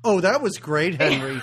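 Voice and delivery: loud, sarcastic mock praise; mock sarcastic outburst. Host acts out wife berating Henry, loud mock sarcasm. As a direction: throw out fake praise loudly, amused. Acting out wife's scathing sarcasm.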